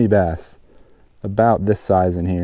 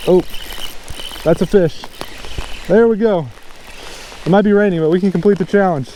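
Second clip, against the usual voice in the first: excited, higher-pitched real burst; 8 semitones higher than usual. Real, not acted. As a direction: call out the catch, pitched well up. Hooks a fish in the rain.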